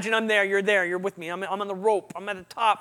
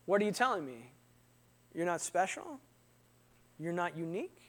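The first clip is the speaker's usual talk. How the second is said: hushed hurt question; 5 semitones lower, 12 dB quieter than usual. Retold or imitated. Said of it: "I felt like he was saying".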